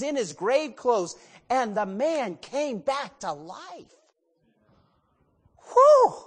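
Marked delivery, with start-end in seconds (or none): 5.7-6.1 sharp high whew of amazement; loud whew. Acted. Preacher exhales after a miracle story.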